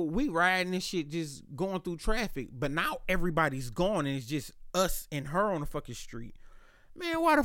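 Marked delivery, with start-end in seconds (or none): none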